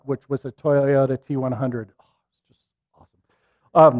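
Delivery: fond whispered aside about the truck. Real, not acted. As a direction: drop to a whisper as if sharing a secret.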